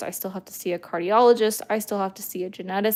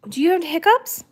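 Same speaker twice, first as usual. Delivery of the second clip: high squeaky pet voice; 9 semitones higher, 6 dB louder than usual. Real, not acted. Cooing to dog.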